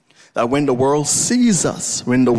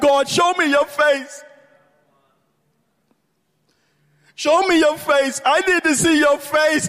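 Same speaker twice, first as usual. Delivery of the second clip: very high, impassioned plea; preacher pleading desperately at a raised pitch; crying out to God; 13 semitones higher than usual. Real, not acted. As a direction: cry it upward, hungry for an answer. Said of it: "I was crying out to God".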